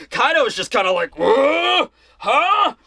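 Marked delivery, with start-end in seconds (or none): 1.2-2.7 high, rising mock bafflement; Kaido taken aback. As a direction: react as if caught off guard. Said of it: "kinda taken aback by that".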